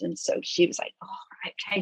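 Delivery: whispered, very soft. Real, not acted. Telling the panel about Sophie, hushed whisper.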